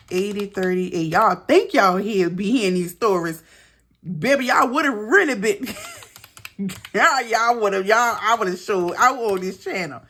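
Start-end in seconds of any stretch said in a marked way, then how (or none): 1.1-10.0 higher, louder, laughing through the words; excited laughing aside. Real, not acted. Telling viewers about her stories, excited and laughing. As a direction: tease the viewers, half laughing.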